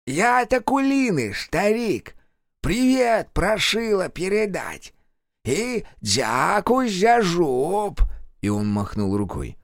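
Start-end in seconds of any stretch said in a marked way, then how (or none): 0.1-8.0 high, slow, otherworldly drawl; eerie possessed man. Acted. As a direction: sneer it like a possessed servant of a witch. Kravchuk's voice.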